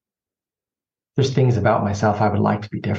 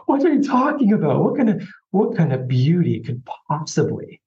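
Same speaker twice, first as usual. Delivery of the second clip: exasperated; 6 semitones higher than usual. Retold or imitated. Arguing with the voice in his dream, exasperated. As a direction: protest against something absurd. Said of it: "I swear in the dream".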